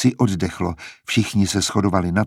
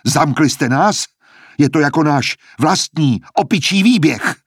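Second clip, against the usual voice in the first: higher, sharper, louder scorn; bitter indignation; 6 semitones higher, 4 dB louder than usual. A narrator's reading. Sejanus scorning Dr. Gaul for locking them in, loud and bitter. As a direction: spit out the monkey-cage jab. Sejanus finds door locked.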